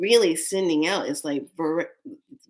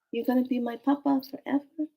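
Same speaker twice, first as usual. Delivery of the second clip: put-on little girl; 6 semitones higher, 3 dB quieter than usual. Child looking up at papa.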